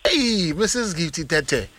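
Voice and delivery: usual speaking voice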